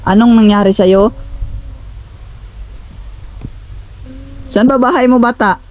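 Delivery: insistent questioning call. Real, not acted. Calls out to child.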